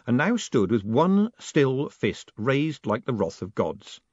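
ordinary delivery, nothing marked

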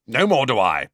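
loud, emphatic agreement; forceful. A narrator's reading. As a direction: punch it out with force. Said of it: "said Uncle Vernon forcefully".

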